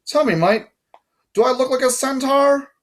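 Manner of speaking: high-pitched put-on British voice; strange British accent. A narrator's reading. Guard questions boy who misread his name, strange British accent. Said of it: "said in a strange accent, British maybe".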